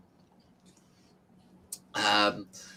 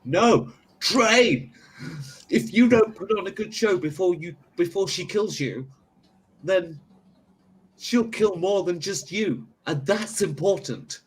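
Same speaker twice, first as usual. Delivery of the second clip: raised, urgent trainer voice; insistent trainer; 8 semitones higher than usual. Acted. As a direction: push him to train.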